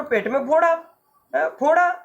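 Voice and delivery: high alarmed question about "फोड़ा"; a mother frightened for her son; trembling fear. Acted. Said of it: "कांप कर". (काँपती, डरी हुई माँ)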